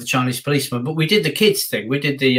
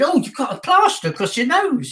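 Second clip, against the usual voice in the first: much higher, playful host voice; talking to a child; 12 semitones higher than usual. Retold or imitated. Performer chats to kid about plaster, high and playful. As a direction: coo in mock surprise at a kid. Acted interview with child on stage.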